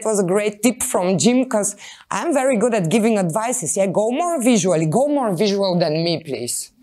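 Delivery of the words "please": ordinary delivery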